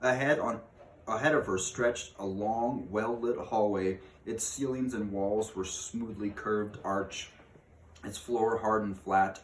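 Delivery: ordinary delivery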